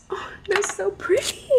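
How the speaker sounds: high, breathy whispered gushing; awed whisper. Real, not acted. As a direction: whisper it in high-pitched delight. Gushing over the sunglasses.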